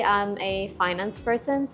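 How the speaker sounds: usual speaking voice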